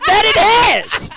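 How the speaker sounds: very high, loud, laughing villain line; hammed-up Guardian. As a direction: stay in the mad character while cracking up. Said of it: "pretending to be insane".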